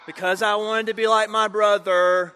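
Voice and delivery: milk it for an aw from the crowd; playing for sympathy. Repeated after cueing crowd's aw.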